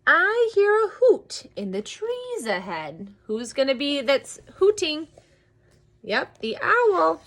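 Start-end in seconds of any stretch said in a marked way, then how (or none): 0.1-3.1 high, slow, wondering creature voice; storybook Gruffalo. Acted. Gruffalo telling mouse what it hears, slow high storybook voice. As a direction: announce it slowly and suspensefully. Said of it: "till the Gruffalo said".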